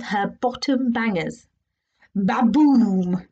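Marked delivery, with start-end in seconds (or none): 2.2-3.3 booming fart noise. A narrator's reading. Bottom banger sound effect.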